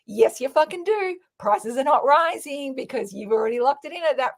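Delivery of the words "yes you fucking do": usual speaking voice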